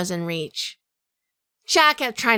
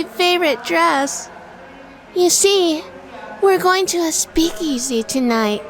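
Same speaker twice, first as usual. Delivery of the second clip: high, sugary pretend-wife act; high, bright fake-innocent wife voice; put-on sweet wife voice; 8 semitones higher than usual. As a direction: play the fussy wife for the clerk. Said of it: "I passed them the claim check".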